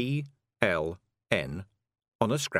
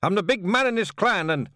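loud, high bluster; angry boast; 13 semitones higher, 6 dB louder than usual. Acted. Rob shouts he is the big man, in loud bluster. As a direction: puff up and shout.

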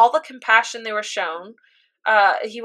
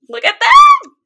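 much higher, louder gush; squealing delight; 11 dB louder than usual. Real, not acted. A fan gushing over the final family picture, squealing.

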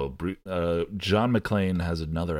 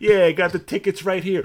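high, loud, quick mock bravado; John McClane; 10 semitones higher, 6 dB louder, faster than usual. Host re-enacting the hero before the shooting, hammed-up bravado. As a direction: fire the line off fast, pitched up.

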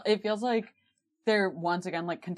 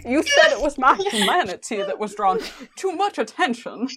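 a laughing Dean voice, higher and louder; Dean impression, laughing; 6 semitones higher, 7 dB louder than usual. Nailing the Dean impression for friends, laughing. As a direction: land the Dean voice while laughing. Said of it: "It's so perfect".

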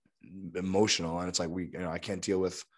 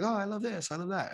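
mocking gushy lover; 12 semitones higher, 4 dB quieter than usual.

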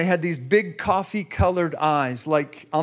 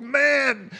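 choked up; 7 semitones higher than usual. Retold or imitated. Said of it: "like, crying".

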